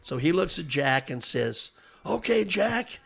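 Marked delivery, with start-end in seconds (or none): 2.1-3.0 a hint of a big gravelly official, higher and coaxing; gravelly steward voice. Steward Bill Carey soothing a furious Jack, lightly gravelly. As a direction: coax the driver like a smoky old phys-ed teacher. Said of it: "He's got a gravelly voice like that".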